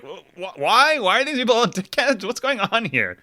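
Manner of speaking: high-pitched incredulous questioning; baffled exasperation. Acted. Host reacting to nasty coworkers, baffled and exasperated. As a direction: stammer into a high, bewildered complaint.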